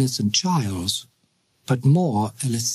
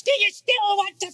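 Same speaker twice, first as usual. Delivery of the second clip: shrill ghostly cry. A narrator's reading. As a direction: wail his name like a phantom warning. Climax of haunting voices.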